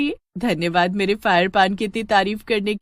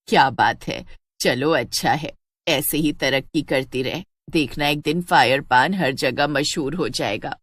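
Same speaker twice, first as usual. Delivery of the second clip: put-on lower, mellow voice of an elderly mother-in-law; old mother-in-law; 6 semitones lower than usual. Elderly mother-in-law pleased with Sheila's news, lower voice. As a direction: speak low and fond, like an old mother praising her daughter-in-law.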